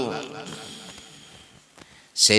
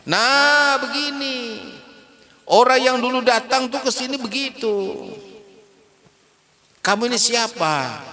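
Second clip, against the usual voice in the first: put-on high, strained old man's voice; old blind man; 12 semitones higher than usual. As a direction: pitch it up like a frail old man.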